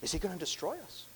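high, hushed worried question; fearful question. Acted. Demon dreading that Christ will destroy them, hushed fear. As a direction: ask it softly, pitch rising in fear. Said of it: "There could have been a fear here".

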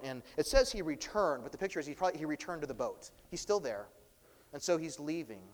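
ordinary delivery

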